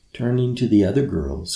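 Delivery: plain reading voice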